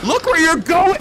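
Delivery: high, loud frustrated yell. Real, not acted. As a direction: yell it at the screen. Yelling at character in game.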